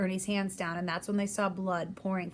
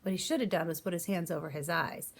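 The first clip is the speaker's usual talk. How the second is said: plain reading voice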